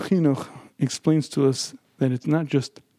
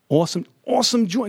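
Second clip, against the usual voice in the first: much higher, quicker, insistent delivery; said with meaning; 7 semitones higher, faster than usual. Retold or imitated. Rabbi urging a class to repeat after him, said with meaning. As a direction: say it like you really mean it. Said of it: "I want you to say it with meaning".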